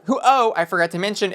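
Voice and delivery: normal talking voice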